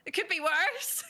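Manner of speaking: put-on Scottish accent. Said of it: "talk in a Scottish accent".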